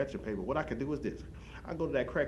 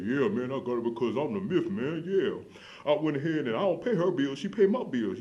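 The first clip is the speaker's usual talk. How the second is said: louder, put-on voice of a boastful hub regular; guy at the hub; 4 semitones higher, 6 dB louder, slower than usual. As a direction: play the bragging older man at the bus stop. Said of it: "He said".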